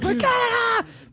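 long, very high hammed-up yell; mock battle cry. Comic telling of getting kicked out shirtless, mock high yell. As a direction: wail it out in a drawn-out mock cry.

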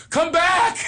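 high, loud call after someone; yelling. Retold or imitated. Calling the ex-wife back to the dead car, yelling. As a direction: holler it out from the car door. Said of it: "Now I have to yell out".

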